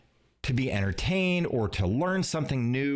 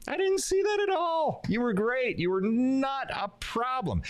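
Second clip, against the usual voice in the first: high, bright-eyed reassurance lifted well above his voice; calm a shaken speaker with praise; reassuring; 12 semitones higher than usual. Retold or imitated. Coach reassuring a nervous speaker after a talk, high and encouraging. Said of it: "And I go".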